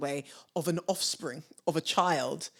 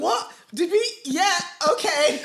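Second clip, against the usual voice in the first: stunned disbelief; 9 semitones higher than usual. Retold or imitated. Retelling their stunned reaction.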